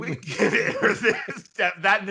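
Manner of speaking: words stretched out through laughter; helpless laughing. Real, not acted. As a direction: talk while cracking up. Co-host cracks up at joke.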